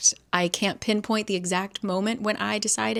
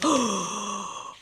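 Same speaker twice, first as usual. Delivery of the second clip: sharp gasp. Real, not acted. Reader discovers part two.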